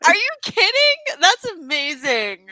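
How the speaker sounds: high, loud delighted exclamation; delighted disbelief. Real, not acted. Co-host reacting to the favorite character, thrilled. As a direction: pitch it way up, then stretch the praise out.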